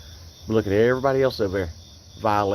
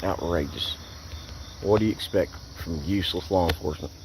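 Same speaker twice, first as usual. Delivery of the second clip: disgusted mutter; 6 semitones lower, 6 dB quieter than usual. Real, not acted.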